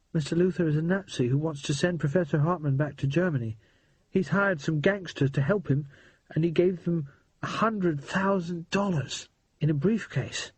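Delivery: put-on a scared but excited boy spilling the news; boy Percy, urgent.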